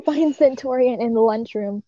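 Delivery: usual speaking voice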